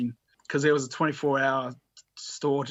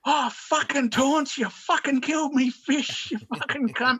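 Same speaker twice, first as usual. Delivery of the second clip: high-pitched, drawn-out mock fury; squeaky, slowed-down imitation of a furious mate; mock outraged rant; 11 semitones higher than usual. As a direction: ham up a friend's shrill outrage at his dead fish. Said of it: "Hunter was like".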